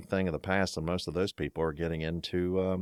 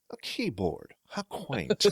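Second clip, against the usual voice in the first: higher, laughing "How quaint"; Scotty's amused quip; 8 semitones higher, slower than usual. Retold or imitated. Host quoting Scotty's keyboard line, amused accent-ish mock. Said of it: "And he said".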